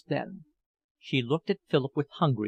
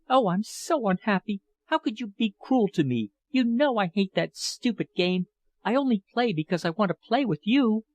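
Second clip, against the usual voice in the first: higher, tearful pleading woman's voice; pleading older lover; 8 semitones higher than usual. A narrator's reading. Older woman begs departing young lover, higher and pleading. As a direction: beg him not to leave, voice lifted and aching. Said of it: "she sobs reproaches".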